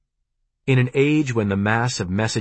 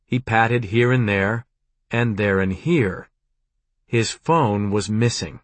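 ordinary delivery

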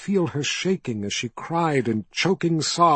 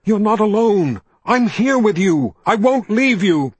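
much higher, louder call of her name; shouted name; 7 semitones higher, 5 dB louder than usual. A narrator's reading. Richard calling to Kaelin, desperate shout. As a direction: yell her name to pull her back.

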